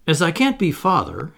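a touch of weighty disappointment; said heavily. A narrator's reading. Humblest Toodles after being refused as father, said heavily. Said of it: "he said heavily".

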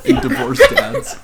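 squeaky high laughing. Real, not acted. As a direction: giggle through the words.

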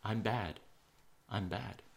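softly imitated child's self-talk; hushed imitation of whispering to oneself; mock whisper. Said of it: "whisper to themselves".

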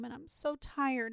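put-on slow, breaking little-boy sob; tearful. A narrator's reading. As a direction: let it crumble into tears. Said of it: "here he broke down again".

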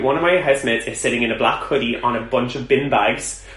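ordinary talk

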